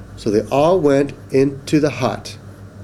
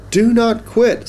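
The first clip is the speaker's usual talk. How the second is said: much higher, emphatic urging; urging encouragement; 10 semitones higher, 3 dB louder than usual. Acted. Pig urges Duck to keep at the nut, encouraging. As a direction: pitch it up high to cheer them on.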